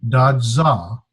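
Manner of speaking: da za in two distinct syllables; careful, separated da za. Shown as a demonstration. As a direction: sound out da, then za with a buzzed z. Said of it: "and this zeta sound".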